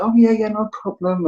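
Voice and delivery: gushing yes to the official; eager yes. Retold or imitated. His past self to the clerk, eagerly agreeing. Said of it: "I was like".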